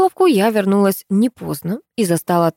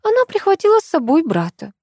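high-pitched animated friend's voice; high, lively storytelling to a friend; Tamara explaining; 8 semitones higher than usual. A narrator's reading. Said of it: "пояснила Томочка".

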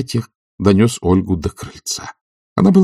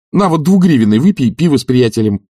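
high, loud urging; pushy master; eager bribing; 7 semitones higher, 5 dB louder than usual. A narrator's reading. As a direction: tempt him out with animated insistence. Master bribing servant to leave.

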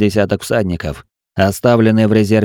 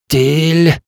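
breathless gasp after surfacing; gasping for air; 6 semitones higher than usual. A narrator's reading. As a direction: gasp the name out, half drowned. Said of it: "выдохнул я, выплёвывая воду".

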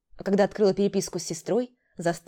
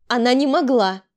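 loud, higher-pitched defence; protesting; 5 semitones higher, 8 dB louder than usual. A narrator's reading. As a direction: insist loudly on the daughter's innocence. Mother defends daughter against accusation. (громко возражать)

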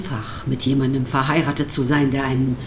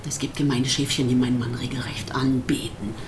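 whispered and much softer; 4 semitones lower, 7 dB quieter than usual. Acted. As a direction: let it slip out as a bitter whisper. Bitter wife about husband's admirers.

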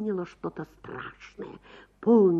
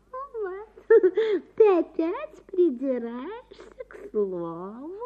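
wincing annoyance; 12 semitones higher, 6 dB louder, slower than usual. A narrator's reading. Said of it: "поморщилась дамочка".

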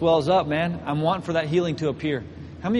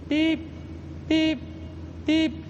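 high, short electronic beeps, evenly spaced; imitated monitor beep; 11 semitones higher, 5 dB quieter, much slower than usual. Preacher mimics hospital electrodes, high steady beeps. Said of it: "hooked me up to the electrodes going beep".

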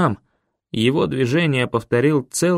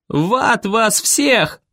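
higher, slow, defiant yell; furious outburst; 8 semitones higher, much slower than usual. A narrator's reading. Boy defying the whole crowd, furious slow yell. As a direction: spit it out as a war cry. Boy rebels before drawing knife.

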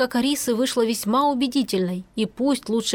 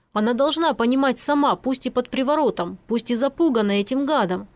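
plain reading voice

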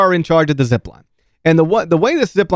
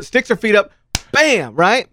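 ordinary talk

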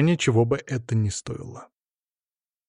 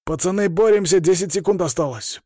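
higher-pitched referee's urging; 6 semitones higher than usual. A narrator's reading. Referee to the wrestlers in the last seconds, urging them on. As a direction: hurry the wrestlers along. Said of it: "поторопил Игнат".

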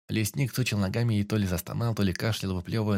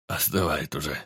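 broken voice; 6 semitones lower than usual. A narrator's reading. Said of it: "прерывистым голосом сказал Сарафанов".

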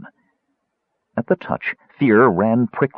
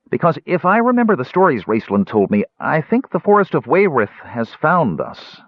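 higher, earnest kender voice; grave kender; 8 semitones higher than usual. Acted. Tas breaking the news to Caramon, high and earnest.

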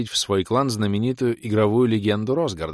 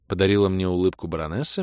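usual speaking voice

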